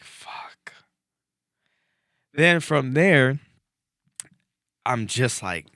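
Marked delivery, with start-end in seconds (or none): 0.0-0.7 breathy whispered curse under the breath. Retold or imitated. Driver sees the gas light, whispers a curse. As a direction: hiss it to yourself, voiceless.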